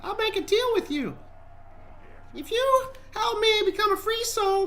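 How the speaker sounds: very high put-on soul voice; squeaky little spirit bargaining; high-pitched voice. Trapped soul offers explorer a deal, high-pitched voice. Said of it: "I couldn't even do my high-pitched voice".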